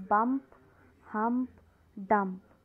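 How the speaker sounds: loud, clear rhyming words read out; said aloud. Shown as a demonstration. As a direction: read each word out loud for the class. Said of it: "Say aloud". (ज़ोर से बोले गए तुकांत शब्द)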